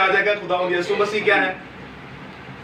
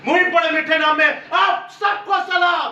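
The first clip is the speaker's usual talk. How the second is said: higher, ringing greeting to the congregation; preaching at full force; 6 semitones higher than usual. Real, not acted. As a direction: proclaim it like a rousing revival opener.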